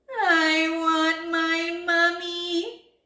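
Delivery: high, loud, slow drawn-out wail; tearful wail. A narrator's reading. Number five crying for mummy, long wail. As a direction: wail it like a little one crying. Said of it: "A fat little tear rolled down".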